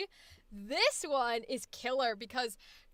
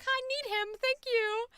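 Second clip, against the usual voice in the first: high, sweet and polite; politely ask, a little timid; cute polite voice; 10 semitones higher than usual. Retold or imitated. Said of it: "She's just like".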